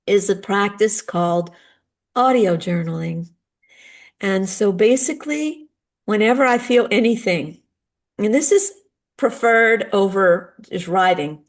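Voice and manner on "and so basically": ordinary delivery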